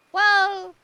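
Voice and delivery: eager loud well. Real, not acted. Eager interruption.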